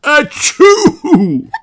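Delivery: sneezing high pony voice; sick little pony, sneezing; sniffly pony voice. Acted. Pedro voiced.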